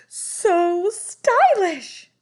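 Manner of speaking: high, loud, very slow gush; excited cry. A narrator's reading. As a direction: cry it out in delight. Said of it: "they cried".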